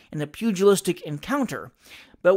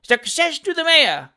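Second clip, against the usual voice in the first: much higher, louder heckle; mocking cry; 8 semitones higher, 6 dB louder than usual. A narrator's reading. Voice in the crowd heckling the mayor, loud mocking cry. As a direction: cry out a sarcastic cheer. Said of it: "cried the voice".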